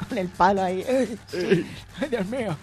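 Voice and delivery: ordinary talk, nothing marked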